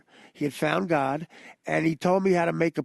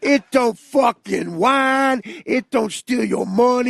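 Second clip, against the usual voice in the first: slow, louder, higher mock ranting; inmate's rant; 6 semitones higher, 5 dB louder, much slower than usual. Inmate preaching toilet paper, loud slow mock rant. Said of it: "this is the rant he goes on".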